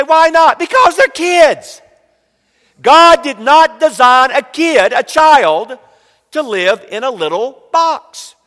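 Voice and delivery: high, loud, slow preacher cry; preaching at full force. Acted. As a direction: drive it home to the congregation.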